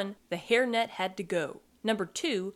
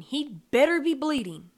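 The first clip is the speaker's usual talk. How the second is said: loud, higher-pitched irritated shout; annoyed raised voice; 5 semitones higher, 5 dB louder than usual. A narrator's reading. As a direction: shout it crossly across the house.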